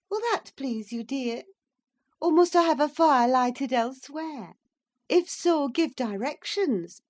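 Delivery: mock the master's snobbery gaily; half angry, half laughing Catherine. A narrator's reading. Catherine teases Edgar about where to seat Heathcliff, half laughing.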